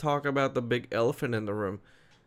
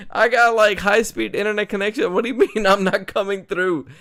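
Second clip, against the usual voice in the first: laughing; 9 semitones higher, 6 dB louder, faster than usual. Real, not acted. Laughing protest about his connection.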